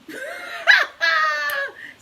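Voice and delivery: high, loud burst of laughter; hearty laugh. Real, not acted. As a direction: laugh out loud at your own remark. Laughs at her own remark.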